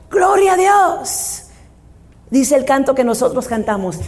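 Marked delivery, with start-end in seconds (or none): 0.1-1.4 drawn-out, higher ringing cry of glory; slow, high, joyful church shout of "Gloria"; shouted praise. Retold or imitated. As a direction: call it out slowly like a victory shout. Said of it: "y gritaban".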